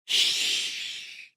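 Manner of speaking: whispered shh; hushing. A narrator's reading. Mother hushes her son, whispered. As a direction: soothe with a breathy hush. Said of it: "Mum says".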